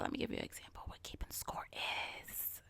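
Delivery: soft hushed whisper. Shown as a demonstration. Telling podcast listeners in a whisper. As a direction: lean in and whisper it like a secret. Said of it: "Gotta put it in my whispering voice".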